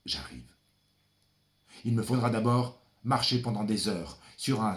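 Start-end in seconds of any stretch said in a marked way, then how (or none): 0.1-0.5 soft whispered arrival; hushed whisper. Acted. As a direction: breathe it, barely voiced. Hushed reply to distant father.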